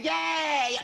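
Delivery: high-pitched pretend cheer; high, drawn-out hooray; mock cheer. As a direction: cheer like a delighted little organ. Voices a cheering liver.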